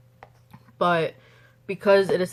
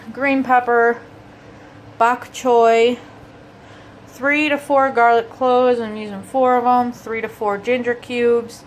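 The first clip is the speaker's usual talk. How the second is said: slow, higher cooking show voice; recipe rundown; 5 semitones higher, 5 dB louder than usual. Acted. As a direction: list each ingredient like a cooking host. Reads out the recipe ingredients.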